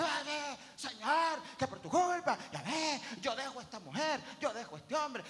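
a touch of a whining believer; complaining believer. Retold or imitated. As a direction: moan at the Lord. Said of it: "Y tú".

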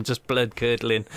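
ordinary talk

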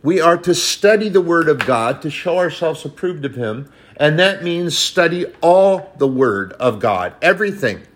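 ordinary delivery

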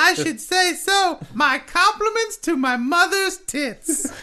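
high, slowed-down bartender voice; mixologist character. Pretend. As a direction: play a drawling, high-pitched mixologist. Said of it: "call him a mixologist".

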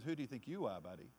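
sassy comeback. Pretend. Believer turning the devil's question back, hand on hip. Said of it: "put your hand on your own hip and turn around and says".

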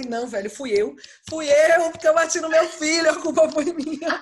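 loud, high wailing confession; mock despair. Mother blaming herself for the seven-one loss, loud and laughing. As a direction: cry out the guilt, laughing through it.

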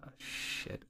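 breathless whisper under the breath; whispered oh shit. Retold or imitated. Caller realizing he can't speak Arabic, whispered. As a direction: whisper it like you're caught out. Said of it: "I was like".

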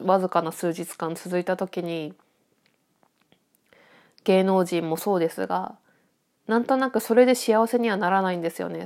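usual speaking voice

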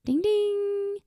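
high sung ding ding; a playful bell imitation; mock bell ding. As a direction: chime like a quiz bell. Correct answer bell.

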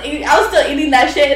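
usual speaking voice

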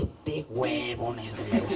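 slow, hushed grumble to oneself; muttered disbelief. Retold or imitated. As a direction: drag it out softly, as a private thought. Pensamiento incrédulo sobre su amigo. (murmullo incrédulo, lento y bajito)

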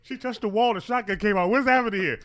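high, loud alarmed exclamation; mock panic. Bystander watches shotgun trap go off, mock panicked. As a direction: act out comic alarm at full volume.

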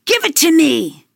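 witch screaming. A narrator's reading.

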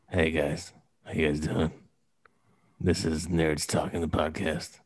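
low, soft creepy greeting; creepy dude. Pretend. Creep hosting a nerd podcast, creepy. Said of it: "What, talking creepy?".

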